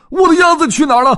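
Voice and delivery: high, loud, fast panicked shout. Acted. Dodds shouting for his lost duck, frantic and loud. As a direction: yell it out in full alarm. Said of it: "他顿时惊慌失措".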